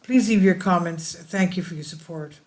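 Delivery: normal talking voice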